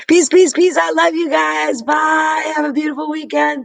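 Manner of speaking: high, loud, happy send-off; excited farewell. Real, not acted. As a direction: shout your goodbyes up high. Excited farewell to live viewers.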